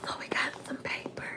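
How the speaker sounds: very soft whisper to herself; whispered aside. Real, not acted. Vlogger opening mail to herself, whispered aside. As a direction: whisper it under your breath, barely audible.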